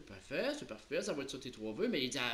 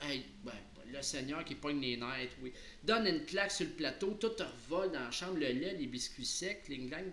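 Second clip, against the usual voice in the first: ordinary delivery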